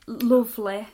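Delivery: "Lovely" with the northern foot vowel, "loovely"; northern English "Lovely", rounded u. Shown as a demonstration.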